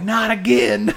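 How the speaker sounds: high, loud cry of despair; re-enacted dismay. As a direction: wail in exaggerated parental despair. Acting his horror at the mess.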